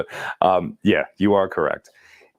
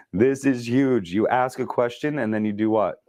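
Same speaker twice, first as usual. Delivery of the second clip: normal talking voice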